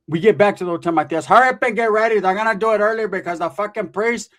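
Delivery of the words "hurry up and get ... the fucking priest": high, loud bossy rush; urgent hurrying. Retold or imitated. Tia hurrying everyone to the early wedding, loud urgent order. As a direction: push them out the door, loudly.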